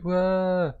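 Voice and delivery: high, drawn-out pretend bawling; mock child's wail. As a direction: wail like a crying toddler. Voicing the crying child.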